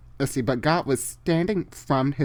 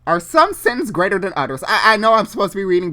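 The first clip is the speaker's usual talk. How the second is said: agitated fast aside; 6 semitones higher, 8 dB louder than usual. Real, not acted.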